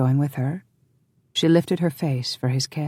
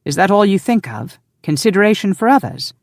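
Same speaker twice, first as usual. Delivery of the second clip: raised, louder incredulous challenge; higher, exasperated questioning; 6 semitones higher, 5 dB louder than usual. A narrator's reading. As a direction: protest in exasperated disbelief. Exasperated husband challenges her.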